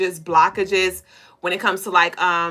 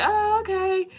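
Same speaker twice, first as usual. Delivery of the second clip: unimpressed; 11 semitones higher than usual. Retold or imitated. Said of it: "somebody is like".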